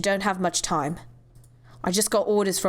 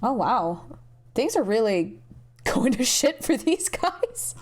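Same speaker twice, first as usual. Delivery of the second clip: laughing aside; slower than usual. Acted. Amused aside at chaos.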